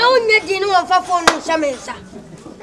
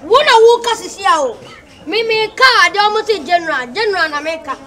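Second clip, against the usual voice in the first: angry retort; 3 semitones higher, 3 dB louder than usual. Acted. Heated argument over the father.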